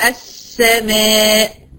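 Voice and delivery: long hissed "ss" held in السماء; doubled seen. Shown as a demonstration. Teacher showing two seens in one word, drawn-out "ss" in السماء. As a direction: slowly stretch the silent س, then open into "sa" in السماء. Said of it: "بنطق اتنين سين".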